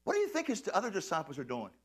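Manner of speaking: normal talking voice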